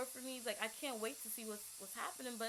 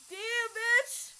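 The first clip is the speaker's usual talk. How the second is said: exasperated outburst; 12 semitones higher, 10 dB louder than usual. Real, not acted. Exclaiming at herself, high and loud.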